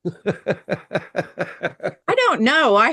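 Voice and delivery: slow, drawn out through laughter; laughing. Real, not acted. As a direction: stretch it out while laughing. Laughing at the Okie joke.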